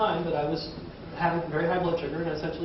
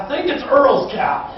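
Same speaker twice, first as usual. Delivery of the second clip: slow, louder southern caller; southern accent; 7 semitones higher, 7 dB louder, slower than usual. Retold or imitated. Caller naming the cow's owner, southern accent. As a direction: draw out Earl's cow southern style. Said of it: "North Idaho has a southern accent".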